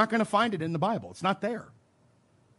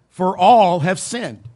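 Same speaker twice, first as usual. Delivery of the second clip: "all" drawn out loud and slow; emphatic stress; 9 dB louder, slower than usual. Shown as a demonstration. Preacher stressing a verse to the congregation, emphatic "all". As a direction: lean hard and long on "all". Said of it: "you could just emphasize".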